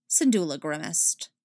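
ordinary delivery

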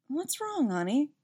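lightly voiced mom, high start; concerned mom. A narrator's reading. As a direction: gently ask with a hint of motherly concern. Concerned mother asks her son.

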